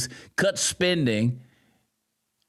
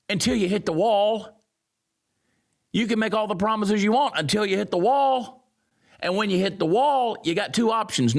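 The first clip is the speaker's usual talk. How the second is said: emphatic rant; 7 semitones higher than usual. Real, not acted. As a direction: pound the point home, voice up. Ranting emphatically.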